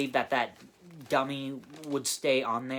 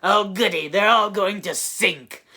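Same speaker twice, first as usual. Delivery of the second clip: mocking villain; 6 semitones higher, 9 dB louder than usual. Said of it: "one line that he says is".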